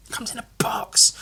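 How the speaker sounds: hushed awed whisper. Real, not acted. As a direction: whisper it like a secret. Marvels at the box.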